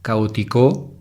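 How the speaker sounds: "Caótico" with the stress on the final "-co"; stress on the last syllable. Shown as a demonstration. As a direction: say "Caótico" as caotiCO. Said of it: "No, ¿verdad?". (acento en la última sílaba)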